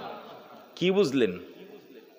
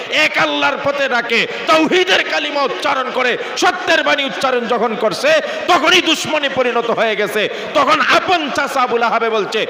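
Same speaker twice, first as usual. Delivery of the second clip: higher and louder, building preacher's cry; fiery sermon; 7 semitones higher, 4 dB louder than usual. Acted. Preacher thunders Abu Lahab's insults at congregation, fiery. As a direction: preach it up, voicing the uncle's insults.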